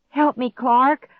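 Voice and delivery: high, loud call for help; pleading. A narrator's reading. Girl begs frozen stepbrother, pleading. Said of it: "I pleaded".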